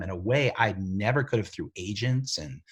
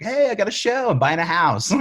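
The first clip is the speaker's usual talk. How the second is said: a high, loud, cocky brag mimicking his younger self; mock bragging; 9 semitones higher, 8 dB louder than usual. Mocking his younger self bragging to friends, high and loud. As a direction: crow about the new show like a show-off.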